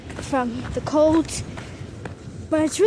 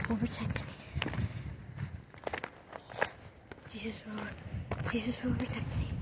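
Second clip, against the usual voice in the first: soft, low trembling prayer; murmured prayer; 3 semitones lower, 12 dB quieter than usual. Real, not acted. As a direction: whisper a prayer to yourself.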